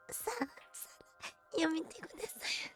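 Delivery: very high, breathy whispered voice; squeaky cute whisper. Shown as a demonstration. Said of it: "Je sais plus le faire".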